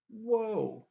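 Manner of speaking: high, drawn-out sarcastic whoa; mock whoa. Viewer reacting to the reveal, hammed-up whoa. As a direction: feign being floored. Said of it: "being sarcastic".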